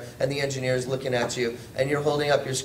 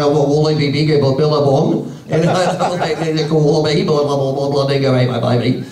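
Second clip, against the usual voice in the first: a loud, lightly mocking Australian accent, rushing the words; a loud half-joking Australian accent; 7 dB louder than usual. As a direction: mimic a thick Aussie accent he can't follow. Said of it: "he had the thickest accent".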